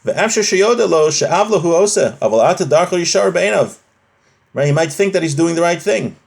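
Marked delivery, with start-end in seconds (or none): none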